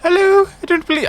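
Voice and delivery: very high, loud woman impression; exaggeratedly high put-on female voice. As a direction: attempt a woman's voice in falsetto. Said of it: "try to do a woman voice".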